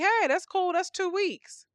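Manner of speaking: high, breezy naive voice; naive American. Retold or imitated. American pleased with two weeks off, breezy and high. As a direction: play the pleased, clueless American. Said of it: "And you think".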